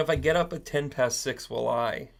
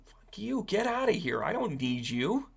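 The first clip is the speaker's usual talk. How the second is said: defiant Ukraine voice; 7 semitones higher than usual. Retold or imitated. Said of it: "Ukraine is saying".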